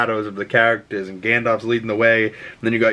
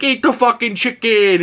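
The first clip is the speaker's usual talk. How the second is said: higher mock demand; pushy driver; 11 semitones higher than usual. Driver insisting Doc eat the chicken, mock demand. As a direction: push the chicken at him, hammed-up. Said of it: "And he's like".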